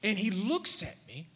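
ordinary talk

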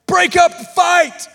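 loud, high commanding yell; tough shout. Retold or imitated. Pastor stepping into a fight, shouting loud and tough. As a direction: bark it to break up a fight. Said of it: "I'm trying to act as manly and as tough".

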